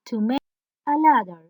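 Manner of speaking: ordinary talk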